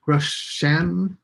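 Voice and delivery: usual speaking voice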